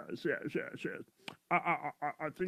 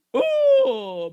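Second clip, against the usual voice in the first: sudden high loud gasp of alarm; shocked cry; 13 dB louder than usual. Acted. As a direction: yelp at what you see. Doctor sees the worm.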